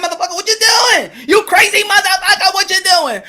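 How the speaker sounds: high, mock-furious scolding; outraged girlfriend. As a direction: burst out in high-pitched comic outrage. Acts out being caught by her.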